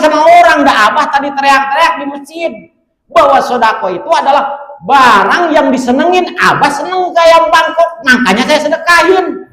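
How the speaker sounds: put-on high, loud, eager child voice; the boy proudly explains what he gave away; earnest child explaining. Son explains to the kiai. (anak menjelaskan dengan suara tinggi dan bersemangat)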